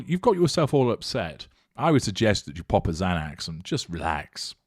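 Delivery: normal talking voice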